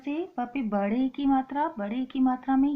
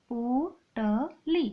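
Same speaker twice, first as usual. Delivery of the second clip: slow word demo; much slower than usual. Shown as a demonstration. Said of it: "प पे ओ की मात्रा पो".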